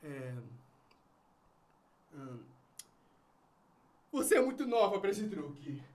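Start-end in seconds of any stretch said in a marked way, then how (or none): 4.1-5.8 quick dismissal. Acted. Father quickly refuses.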